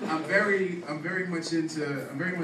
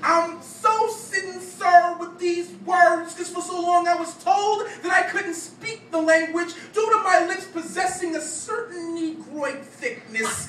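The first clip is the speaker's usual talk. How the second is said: higher, louder, rhythmic slam-poem delivery; spoken-word poetry; 7 dB louder than usual. Acted. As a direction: perform it as a punchy stage poem.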